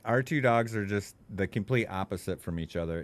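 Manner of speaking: usual speaking voice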